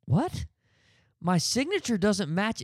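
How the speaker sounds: high, incredulous "What?"; retold disbelief. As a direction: replay your stunned reaction to the clerk. Teller's stunned reply to teller.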